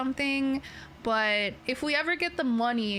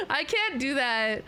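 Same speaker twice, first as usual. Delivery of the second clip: ordinary delivery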